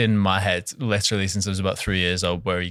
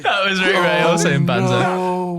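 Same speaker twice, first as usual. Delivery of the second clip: laughing; 8 semitones higher, 4 dB louder, slower than usual. Real, not acted. Laughing about the game voice.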